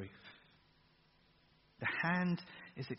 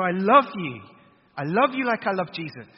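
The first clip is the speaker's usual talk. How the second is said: booming declaration of love, much louder; God declaring love; 11 dB louder than usual. Acted. Preacher voicing God's love to the congregation, loud proclamation. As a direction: proclaim it over them like a voice from heaven.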